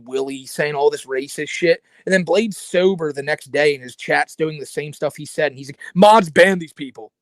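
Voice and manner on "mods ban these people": slow, louder mocking drawl; mock Blade. Mocking the streamer to a co-host, loud and slow. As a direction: ham up the streamer demanding bans.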